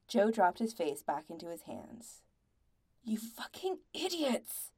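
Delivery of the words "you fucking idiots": slow, high-pitched snarl of contempt. A narrator's reading. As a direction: draw it out, seething at them. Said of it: "Andy practically snarled".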